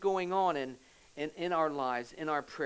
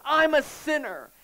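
higher, louder desperate plea to God; crying out; 5 semitones higher, 5 dB louder than usual. Acted. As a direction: cry out as a begging sinner. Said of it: "he cried out to God".

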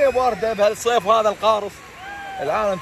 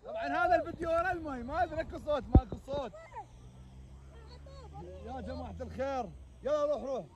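muffled underwater; 5 semitones higher, 14 dB quieter than usual. Real, not acted. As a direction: voice heard through water.

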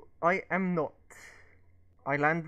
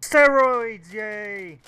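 yelling; 3 semitones higher, 10 dB louder, much slower than usual. Real, not acted. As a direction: yell it out like a cheer. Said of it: "Don't fucking yell".

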